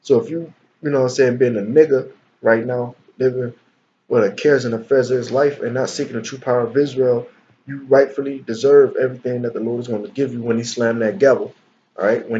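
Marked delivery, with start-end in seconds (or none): none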